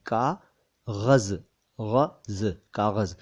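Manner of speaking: कागज़ slowly split as kaa, ghaz, then whole; plain k, dotted gh. Shown as a demonstration. Teacher drilling the word for learners, कागज़ in slow pieces with gh and z. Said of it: "क के नीचे नहीं है".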